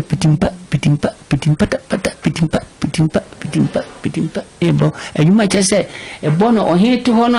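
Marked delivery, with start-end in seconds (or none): 0.0-4.4 quick staccato "pitimpa" drum syllables; vocal drumming in short bursts; imitated drum beat. Shown as a demonstration. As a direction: tap out a drum rhythm with the voice.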